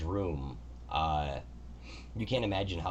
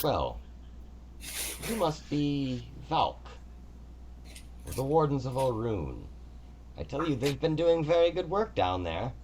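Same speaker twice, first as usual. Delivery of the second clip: a higher, measured divine voice greeting the visitor; god Kelemvor; 8 semitones higher than usual. Acted. God Kelemvor greets the adventurer Valk, higher and stately. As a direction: speak as a gracious, orderly god.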